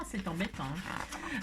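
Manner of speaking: murmuré, en retombant tout bas; répéter « c'est embêtant » tout doucement; en murmurant. Shown as a demonstration. (« c'est embêtant » soufflé presque sans voix)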